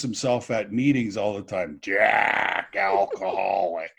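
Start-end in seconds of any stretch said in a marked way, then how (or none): 1.8-3.9 put-on low, slow rasp of an old biker; a light imitation of a gravel-throated old biker; whiskey voice. Old biker introducing himself at a meeting, low slow whiskey voice. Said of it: "he had this permanent whiskey voice".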